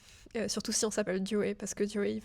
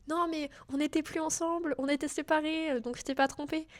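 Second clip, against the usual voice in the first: mock Ross protesting; 7 semitones higher than usual. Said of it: "il y a toujours ce truc de".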